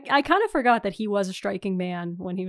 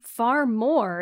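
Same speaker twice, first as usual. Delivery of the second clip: usual speaking voice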